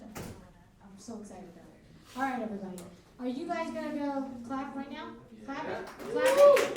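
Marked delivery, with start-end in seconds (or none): 6.1-6.8 loud, high hype shout. Real, not acted. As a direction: yell it at the crowd. Host hypes the crowd.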